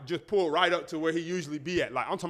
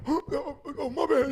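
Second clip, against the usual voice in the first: lightly voiced, a touch higher, groggy drunk; drunk uncle; 7 semitones higher than usual. Drunk uncle woken in his car, groggy and slightly higher. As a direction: mumble it like a drunk just woken. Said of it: "He woke up like".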